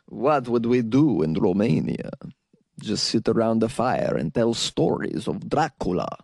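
mock Romanian accent. Musing on visiting a Romanian listener, in a mock Romanian accent. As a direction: draw it out in a hammy Transylvanian accent. Imagines Romanian evenings telling Dracula stories.

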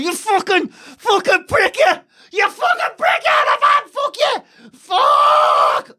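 high-pitched, strained yelling; enraged screaming driver. Retold or imitated. Furious driver screaming at a stranger on the road, acted-out raging screams. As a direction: scream abuse like a man who has lost it. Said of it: "was screaming down the road".

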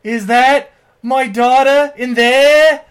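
slow, loud, high anguished wail; Sean Penn impression. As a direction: ham up a father's grief, every word pushed. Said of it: "Sean Penn going full Academy Award thing".

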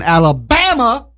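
drawn-out, high-pitched movie-quote Alabama; Forrest Gump. Retold or imitated. Host announcing the state, Forrest Gump-style drawl. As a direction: stretch it out and pitch it up. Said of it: "why I have to say it like that".

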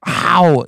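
playful bumped-head ouch; mock cry of pain. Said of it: "And you both go".

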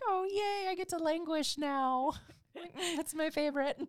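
high, loud little monster voice; depressed monster. Acted. Speaker voicing their inner monster's reward, high mock glee. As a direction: squeal it as a gleeful little monster. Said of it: "it's like my little depressed monster's reward".

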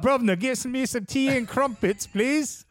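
a cartoon Brit asking for tea and crumpets; mock posh British accent, jokey. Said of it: "British accent from Lane".

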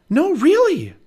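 high loud insisting; insistent Annie. Acted. Annie insists to doubting Jack, loud and high. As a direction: protest that it's true, loud and high.

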